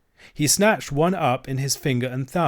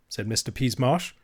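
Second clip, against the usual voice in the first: usual speaking voice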